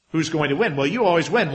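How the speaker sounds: ordinary delivery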